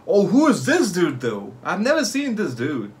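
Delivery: ordinary talk, nothing marked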